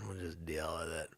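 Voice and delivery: ordinary talk, nothing marked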